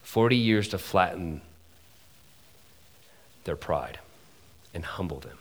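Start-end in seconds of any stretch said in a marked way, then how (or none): 3.4-5.4 lower, soft, fading to a whisper; hushed. Real, not acted. Preacher to congregation, dropping to a hushed whisper. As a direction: let it sink in quietly.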